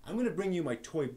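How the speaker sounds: ordinary talk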